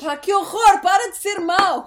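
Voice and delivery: shocked, loud and high; outraged shout. Real, not acted. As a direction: cry out in mock outrage. Reacting to giving chocolates away.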